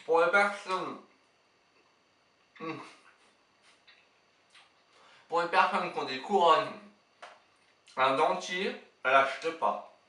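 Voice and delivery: talking while chewing sticky candy; chewing sticky toffee. Real, not acted. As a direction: jaw stuck on chewy candy. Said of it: "Ça colle aux dents".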